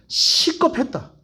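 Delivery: drawn-out, high Gyeongsang "시겁했다"; 경상도 사투리로 길게 "시겁했다"; Gyeongsang dialect word. Shown as a demonstration. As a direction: stretch "시겁했다" slowly like a local. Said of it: "예를 들면".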